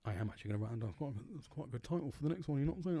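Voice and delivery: quiet aside, half under breath; muttering to himself. Real, not acted. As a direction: mumble it to yourself. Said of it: "that's me talking to myself".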